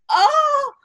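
loud, high-pitched cry of dismay; mock wail of frustration. Retold dismay at cafe refusal.

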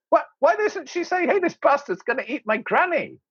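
high, incredulous protest; mock exasperation. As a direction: demand it in hammed-up indignation. Acting old man exasperated at the girl.

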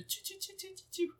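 high, soft rapid chirps; tiny high-pitched tweets; cute bird chirping. Retold or imitated. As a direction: imitate a sweet little budgie. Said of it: "它的声音就很可爱".

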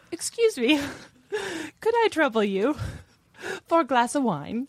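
high, pleading mock Cockney orphan; Oliver Twist beggar. Friend at a bar imitating Caitlin's Oliver Twist order. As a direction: beg politely like a Dickens urchin. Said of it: "as if she were Oliver Twist".

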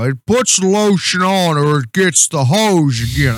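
drawn-out Texan cadence; slow Texas drawl. Acted. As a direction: drawl it slowly like a Texan killer. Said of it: "it's very slow".

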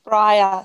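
Bria said firmly as BRY-uh; pronounced BRY-uh. Shown as a demonstration. Mum corrects the host on the name, Bria as BRY-uh. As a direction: answer loudly with Bria as BRY-uh. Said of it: "How do you say it?".

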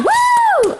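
high, loud cheer; excited whoop. Real, not acted. Host cheering the start of favorite things, excited whoop.

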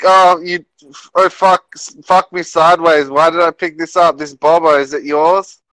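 hammed-up British accent, high and loud; mock British accent. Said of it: "British accent for the whole thing".

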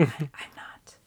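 hushed, slow whisper. Real, not acted. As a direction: whisper it as a joking aside. Joking whisper aside.

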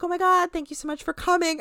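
imitating a hostess saying goodbye with fake cheer; fake cheerful. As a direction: gush as if nothing happened. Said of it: "like, everything's all normal".

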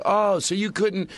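high, drawn-out reproachful "oh"; mock indignant comeback. Acted retort to someone who mocked his fear, indignant. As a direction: throw it back at them, high and slow.

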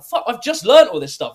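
guy in the sauna knows rollerblading, so an excited blurt; excited exclamation. Retold or imitated. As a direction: blurt it out, amazed at the coincidence. Retold shocked reaction to stranger.

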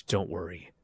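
conspiratorial unvoiced whisper. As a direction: lean in and whisper the scheme. Scheming plan, whispered aside.

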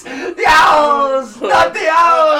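high, slow, screaming rant; Nick Cage meltdown. Pretend. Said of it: "You do a Nick Cage".

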